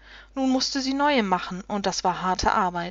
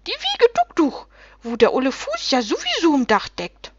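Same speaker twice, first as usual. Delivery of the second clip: shrieking little creatures; 6 semitones higher, 4 dB louder than usual. A narrator's reading. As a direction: start piping high as a delighted little creature, then ease down. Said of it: "schrien die Rumpelwichte".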